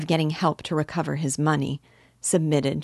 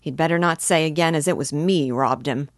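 ordinary delivery

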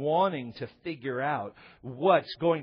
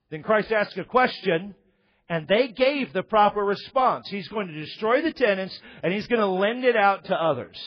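louder, higher preaching build; full-force preaching; 8 semitones higher, 5 dB louder than usual. Acted. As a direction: drive the story home to the congregation.

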